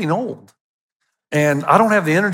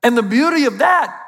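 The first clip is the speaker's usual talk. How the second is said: higher, driving pulpit voice; full-force preaching; 7 semitones higher than usual. Acted. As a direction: preach it out to the whole congregation. Pastor preaching the gospel message.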